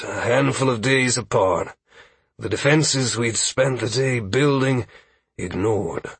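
weary leader in a put-on voice; exhausted village leader. Aldwin reporting on two major attacks, weary leader's voice. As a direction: report gravely, falling away on the last word.

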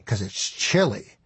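usual speaking voice

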